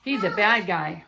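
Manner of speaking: ordinary delivery, nothing marked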